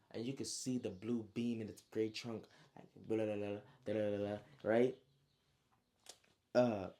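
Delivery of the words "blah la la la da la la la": rolling, babbled gurgle; gurgling noise. Retold or imitated. Storyteller imitating the water beam building, rolling gurgle. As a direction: imitate water bubbling up a trunk.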